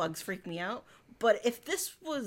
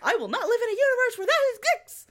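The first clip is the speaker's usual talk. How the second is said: high, fast and louder outburst; indignant rant; 11 semitones higher, 5 dB louder, much faster than usual. Real, not acted. Swearing off a universe with bugs, high fast rant. Ranting about cockroaches.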